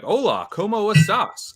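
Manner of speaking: high, slow, eager tourist Spanish greeting. Retold or imitated. Tourist greets a shop clerk in Mexico, eager Spanish accent. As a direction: chirp it like a hopeful gringo in Spanish. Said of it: "I'll be like".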